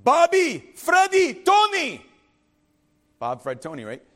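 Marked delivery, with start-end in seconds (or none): 0.0-2.0 high, loud stern call of three names; father summoning his sons in the backyard, commanding shout; dad's commanding call. Retold or imitated. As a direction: call the boys like a strict father across the yard. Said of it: "with that slight accent".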